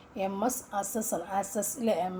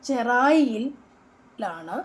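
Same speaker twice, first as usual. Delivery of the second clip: slow emphasis; 2 semitones higher, 6 dB louder, much slower than usual. Shown as a demonstration. Reading quiz question aloud.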